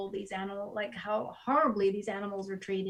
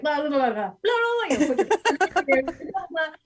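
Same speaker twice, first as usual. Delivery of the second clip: dismissive mock chatter, pitch jumping up; mocking blah blah; 5 semitones higher, 5 dB louder than usual. Mimicking people who dismiss the facts, mocking blah. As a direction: brush it off like you don't care. Mocking careless meat eaters.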